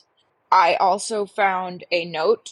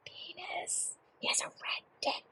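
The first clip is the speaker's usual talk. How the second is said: hushed, unvoiced whisper; a scandalised whisper of the shameful word; embarrassed whisper; 8 semitones higher, 18 dB quieter than usual. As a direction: whisper it so nobody hears. Embarrassed caller lowers voice.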